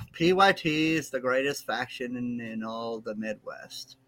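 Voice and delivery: slow reluctant recital, trailing down; insincere, dragging forced praise; grudging, not meant. Real, not acted. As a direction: drag it out as if forced to say it. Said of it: "Say it like you mean it".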